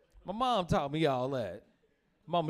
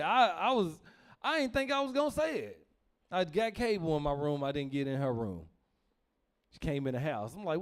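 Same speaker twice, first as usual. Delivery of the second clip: usual speaking voice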